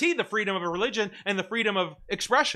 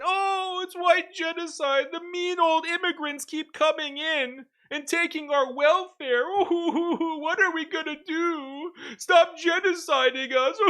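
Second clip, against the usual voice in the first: much higher, slow whimpering wail; mock crying whine; 11 semitones higher, slower than usual. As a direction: whimper it like a crybaby, hammed-up. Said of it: "But then".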